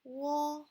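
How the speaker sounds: loud, high, level "Wo"; sustained flat high tone on "Wo"; first tone. Shown as a demonstration. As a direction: hold "Wo" on one high note. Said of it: "one, two, three".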